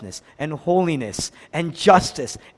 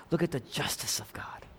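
whisper; 2 semitones lower, 12 dB quieter than usual. Real, not acted. Hushed sermon emphasis.

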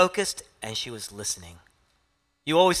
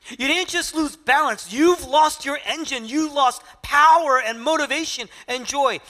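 higher and louder, pressing, heartfelt appeal; impassioned plea; 12 semitones higher, 8 dB louder than usual. Acted. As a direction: preach it as Jesus pleading with Martha, full force. Preacher voicing Jesus to Martha.

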